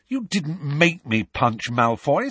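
heated denial in an argument; angry. A narrator's reading. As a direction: snap back with emphasis. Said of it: "said Harry angrily".

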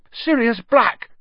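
high, loud cry of terror; terrified cry. Acted. Ron tells the dormitory who attacked him, terrified cry. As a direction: cry the name out in panic.

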